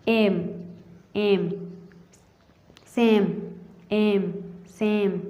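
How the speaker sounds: एम and सेम with long "ay" vowels; long A sound. Shown as a demonstration. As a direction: draw out एम as "aym" and सेम as "saym". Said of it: "long आती है".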